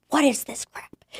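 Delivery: high-pitched, hushed incredulous outburst; mock indignation in a high, half-whispered voice; retold outrage. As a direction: imitate your own outraged disbelief, high and thin. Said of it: "crying in rage".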